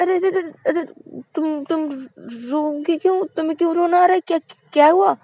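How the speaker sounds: high, loud anxious questions; alarmed girl. A narrator's reading. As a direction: rush to comfort her in alarm. Shruti alarmed. (घबराई हुई ऊँची आवाज़)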